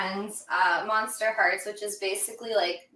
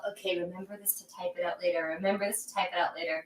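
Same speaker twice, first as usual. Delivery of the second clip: fast, softer note to self; hurried self-reminder; 7 dB quieter, much faster than usual. Retold or imitated. Driver trying to remember an idea, hurried self-reminder. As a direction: rattle it off under your breath.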